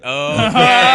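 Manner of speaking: high, loud, startled okay; mock surprise. Comic reacting to a fan's claim, mock surprise. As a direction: feign being caught off guard. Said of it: "I'm like".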